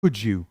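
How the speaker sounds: could you linked as "cujoo". Shown as a demonstration. Teacher models linked could you for repetition. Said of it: "मेरे पीछे repeat करिए".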